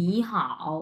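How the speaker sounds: "নি হাও" with rising "নি", low dipping "হাও"; tone change demo. Shown as a demonstration. Teacher modelling both words together, "নি" rising, "হাও" dipping. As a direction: say "নি হাও" as second tone then third tone. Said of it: "দুইটা একসাথে কিভাবে উচ্চারণ করবো".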